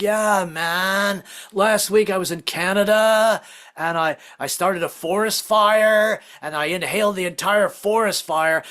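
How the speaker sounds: drawn-out stoner voice; stoned ex-hippie. Acted. Mother Earth high on forest fire smoke, stoned hippie. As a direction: ramble slowly like a blissed-out hippie. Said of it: "she'd be this ex-hippie roaming the planet looking for new ways to get high".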